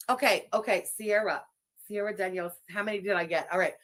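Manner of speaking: normal talking voice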